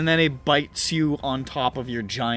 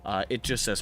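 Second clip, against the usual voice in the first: normal talking voice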